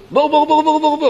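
high, rapid-fire cry of alarm; frantic warning. Retold or imitated. Dragging a friend away from the pit, frantic high cry. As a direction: shout the repeated warning in a high panic. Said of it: "הוא גרר אותו, אומר לו".

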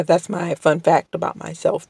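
ordinary delivery

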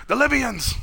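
high-pitched hammed-up warning cry; mock alarm. Doc spots the Libyans coming, mock alarm. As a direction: cry out as if attackers appeared. Acts out Doc spotting the attackers.

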